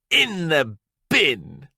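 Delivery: slow, cold headmistress reply; curt headmistress. Acted. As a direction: drop it coldly on the child.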